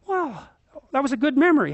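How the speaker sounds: a high, bright wow of discovery; suddenly delighted by the memory; pleased surprise. Retold or imitated. Sudden pleased realisation, inner thought.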